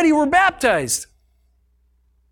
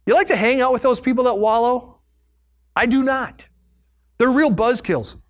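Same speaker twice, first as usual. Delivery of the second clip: ordinary talk, nothing marked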